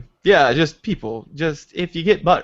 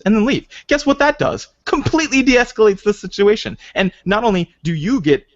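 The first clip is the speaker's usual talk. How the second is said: ordinary talk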